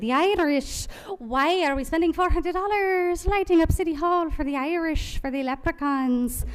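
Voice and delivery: mock Irish brogue, mocking the spending; lightly put-on stage Irish accent; terrible Irish accent. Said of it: "Sorry, I have a terrible Irish accent".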